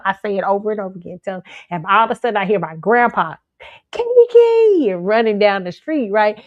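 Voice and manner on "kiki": loud, high-pitched yell of a name; calling out. Retold or imitated. Grandpa running after the little girl, calling out. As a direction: holler for a lost child down the street.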